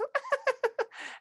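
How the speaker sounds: high squealing giggle; a tickled child laughing. Acted. Child laughing as mother tickles it.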